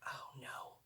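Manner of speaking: dismayed whisper; a whispered oh no on finding the child sick. Retold or imitated. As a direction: breathe it out in alarm. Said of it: "I was like".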